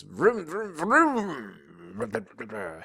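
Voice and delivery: put-on vroom vroom like a toddler's motorbike; mock engine roar, rising then falling; motorcycle noises. A narrator's reading. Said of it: "he yells".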